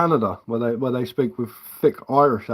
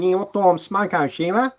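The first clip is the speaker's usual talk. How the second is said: higher-pitched, very nasal mock Aussie accent; nasal Australian; 8 semitones higher than usual. Joking about how Australian accents developed, nasal Australian accent. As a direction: put on an exaggerated Australian twang through the nose. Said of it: "You know, like, proper nasal".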